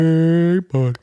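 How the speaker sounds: drawn-out high hello to a dog; long, loud, high coo; friend's baby-talk voice. Retold or imitated. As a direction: imitate a man greeting a baby. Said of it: "that's all he says ever?".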